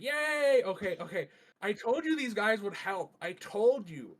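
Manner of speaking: high, loud excited little puppet; giddy, clapping excitement. Acted. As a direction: squeal it out with glee. Bobby voiced excitedly by GM.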